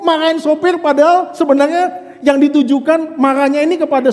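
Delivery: loud, high, driving sermon voice; fiery preaching. Acted. Pastor to congregation about hidden anger, fiery preaching. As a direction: push it hard like a pulpit climax.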